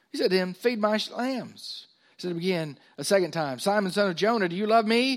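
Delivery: high, loud pulpit reading; scripture reading. Acted. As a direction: proclaim the dialogue from the verse.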